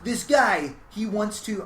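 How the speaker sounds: light New York accent voicing his dad. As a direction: tell it like a New York joke teller. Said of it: "I don't know why I'm doing a New York voice".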